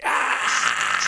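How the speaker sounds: unvoiced mock scream; rasping shout of wild abandon; crazed yell. Acting an actor going crazy on cocaine, mock scream.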